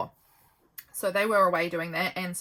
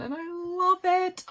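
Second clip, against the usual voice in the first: gushing delight; 10 semitones higher, 3 dB louder, slower than usual. Real, not acted. Gushing over child's drawing.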